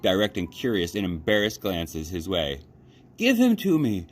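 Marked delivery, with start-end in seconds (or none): 3.2-4.1 high, loud demand; loud high girl demand; girl screaming. A narrator's reading. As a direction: scream for your rabbit back. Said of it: "screamed Abilene".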